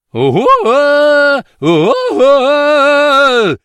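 high, loud drawn-out "ohh" howl; storyteller's wailing ogre call; ogre's hooting cry. Acted. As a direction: hoot it out long, loud and very high. Ogre leaves, pulling door shut.

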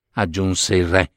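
plain reading voice

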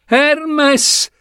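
high desperate call of his name; cry out. Acted. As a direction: shout his name as he falls. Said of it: "gridò Ebe".

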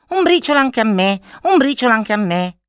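high, loud chattering magpie; gazza supplicante. A narrator's reading. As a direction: beg in a high-pitched bird voice. Same begging magpie returns. (gazza che supplica con voce acuta)

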